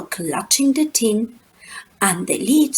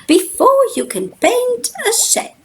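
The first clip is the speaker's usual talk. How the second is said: high Grandmama voice; 13 semitones higher than usual. A narrator's reading. Grandmama lecturing about sheds in a high voice. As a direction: squeak it out like a dotty grandmother. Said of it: "she said".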